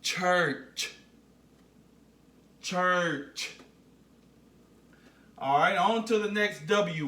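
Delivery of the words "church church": "Church" drawn out, both "ch" sounds distinct; model pronunciation. Shown as a demonstration. Teacher has the class repeat, "Church" drawn out and carefully sounded. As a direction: say "Church" carefully with a firm final "ch". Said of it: "Say it with me".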